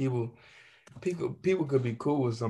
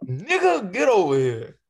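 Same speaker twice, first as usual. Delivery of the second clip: mock yell; 7 semitones higher, 5 dB louder, slower than usual. Mocking the customer's yell.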